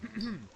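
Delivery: pointed clearing of the throat. Retold or imitated. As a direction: clear the throat before a demand. Kid prepares to make his demand.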